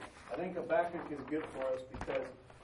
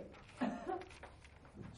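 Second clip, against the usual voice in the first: high breathy laugh; 5 dB quieter than usual. Real, not acted.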